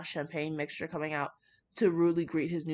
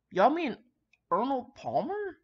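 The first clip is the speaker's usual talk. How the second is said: incredulous; 7 semitones higher than usual. Real, not acted. Mocking sweet iced tea.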